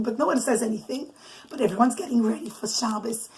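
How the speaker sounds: ordinary delivery, nothing marked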